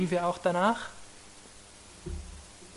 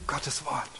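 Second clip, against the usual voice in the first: whisper; 7 dB quieter than usual. Real, not acted. Awed preacher lowers voice.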